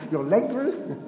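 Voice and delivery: a laughing, disbelieving question; surprised. Retold or imitated. Woman at the house group, amazed at the leg story, laughing disbelief.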